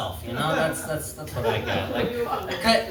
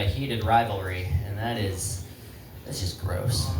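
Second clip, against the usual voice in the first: normal talking voice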